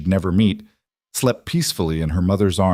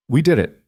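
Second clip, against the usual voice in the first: "We" pitched up, quick correction; higher, louder lift on "We" to correct; automatic correction; 6 semitones higher, 4 dB louder than usual. A narrator's reading. Wife corrects husband's praise, correcting with "We". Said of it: "Leia corrected automatically".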